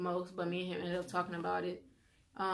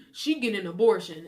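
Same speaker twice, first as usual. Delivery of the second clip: blunt dismissive; 7 dB louder than usual. Retold or imitated. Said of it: "And he was like".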